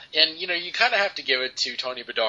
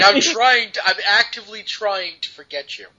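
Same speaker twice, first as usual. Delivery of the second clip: mock exasperated; 9 semitones higher, 6 dB louder than usual. Mock quote in exasperated high voice.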